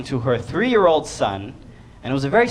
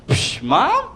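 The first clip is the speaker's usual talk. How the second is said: put-on cocky toddler; 7 semitones higher, 3 dB quieter than usual. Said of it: "And I was like".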